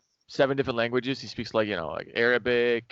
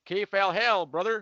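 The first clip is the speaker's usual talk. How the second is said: "Kaf al-hal, brother" in a higher Southern drawl; Arabic greeting with a Southern accent, high; 7 semitones higher, slower than usual. Retold or imitated. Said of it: "a southern accent".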